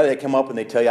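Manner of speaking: ordinary talk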